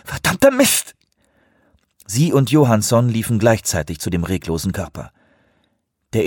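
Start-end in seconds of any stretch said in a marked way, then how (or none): 0.1-0.9 angry. Acted. Man struck by grab.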